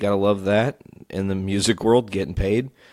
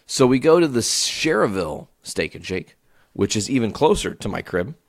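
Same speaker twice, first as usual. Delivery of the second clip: usual speaking voice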